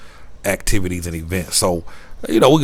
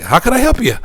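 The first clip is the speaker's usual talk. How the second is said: high, louder Canadian hotel clerk voice; chirpy mock Canadian receptionist; 5 dB louder, faster than usual. Hotel lady greeting the vlogger, high Canadian accent. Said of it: "the lady was like".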